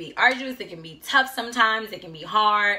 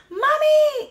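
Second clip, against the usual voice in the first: high, loud, frightened "Mommy!"; scared child cry; 6 dB louder than usual. Retold or imitated. A little daughter crying to her mother in the street, frightened. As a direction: cry out like a startled little girl.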